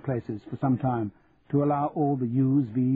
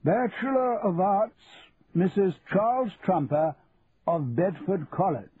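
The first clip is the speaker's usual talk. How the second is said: formal declaration; 7 semitones higher than usual. A narrator's reading. Vice-Chancellor calling graduates up to the king, formal declaration. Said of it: "He then declared".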